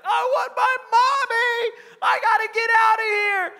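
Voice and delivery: very high, loud imitation of a wailing child; hammed-up little boy screaming in tears; crying scream. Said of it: "he starts crying and screaming".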